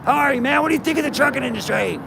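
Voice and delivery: walk right up and hail the trucker; bold friendly greeting. Retold or imitated. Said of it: "No, it's like".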